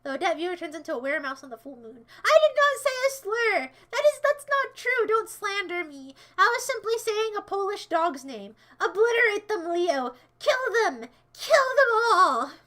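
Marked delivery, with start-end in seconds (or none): 2.2-8.5 higher, louder outraged protest; mock outrage; indignant denial. Real, not acted. 8.8-12.5 louder, higher villain shout; rally the troops, yelling; hammed-up war cry; mock battle cry. Commanding Leo to attack.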